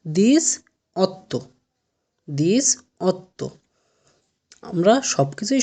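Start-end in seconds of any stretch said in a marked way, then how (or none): 0.1-3.5 দিস অত্ত spelled out slowly, as Italian diciotto; slow pronunciation demo. Shown as a demonstration. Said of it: "আঠারোর কি উচ্চারণ হবে?".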